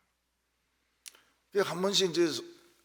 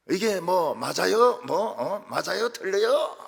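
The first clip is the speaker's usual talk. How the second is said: mock Jeolla dialect argument, much louder; Jeolla dialect quarrel; 6 semitones higher, 8 dB louder, slower than usual. Pastor imitating Jeolla elders quarrelling in Jeolla dialect, loud. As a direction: bicker in Jeolla dialect, each question pushed higher. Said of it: "전라도 말로 싸워요".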